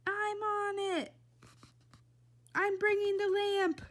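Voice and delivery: high put-on woman's call; Tootie calling out. A narrator's reading. Tootie calls out, rushing to help.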